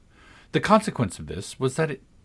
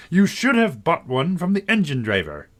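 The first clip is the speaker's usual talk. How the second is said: high, stern guard voice; 7 semitones higher, 4 dB louder than usual. A narrator's reading. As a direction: bark it like a strict guard. Guard speaks.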